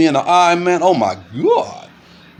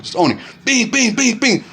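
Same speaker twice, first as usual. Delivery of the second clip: punch sound effects; 8 semitones higher than usual. Retold or imitated. Imitating punches in a fight.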